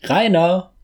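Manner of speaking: high distant call of a name. A narrator's reading. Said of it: "aus dem Wald einen Ruf".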